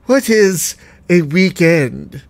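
slow, imperious plummy grande dame; Dowager Countess. Retold or imitated. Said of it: "Sounds like the Dowager Countess from Downton Abbey".

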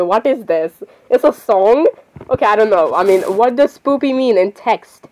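ordinary talk, nothing marked

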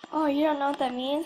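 usual speaking voice

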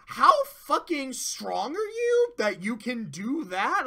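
slow, stunned exclamation; astonished disbelief. Retold or imitated. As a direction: stretch it out in stunned awe. Retold amazed reaction to power.

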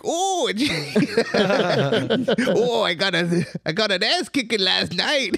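high, beaten-down goat voice; talking goat voice. Retold or imitated. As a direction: whimper it like a battered goat. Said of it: "And he's like".

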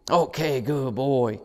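lilting praise to a dog; upbeat puppy praise. Real, not acted. Praises puppy for ringing bells.

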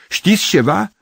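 high, loud, urgent question; worked-up cry; agitated shout. A narrator's reading. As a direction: plead loudly for news. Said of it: "strigă el emoționat".

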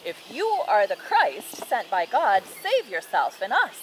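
loud, emphatic taunt read by a storyteller; lightly acted jeering thief; mocking thief. A narrator's reading. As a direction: throw it out as a narrated challenge. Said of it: "One of the thieves who hung beside Jesus joined in".

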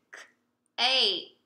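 k, then ache, split apart; sounding out cake. Shown as a demonstration. Teacher sounds out a word for the class, split slowly. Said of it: "the example that we have is cake".

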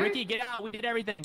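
loud, high shout to a teammate; urgent calling out. Real, not acted. Player tells teammate to leave the ghost, shouting out. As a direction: holler for them to come out.

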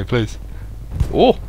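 usual speaking voice

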